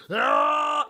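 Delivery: sudden high yelled "Ah!"; a loud startled battle yell; yelled orc attack cry; orc war cry. Retold or imitated. Orc attacks guardsman in story.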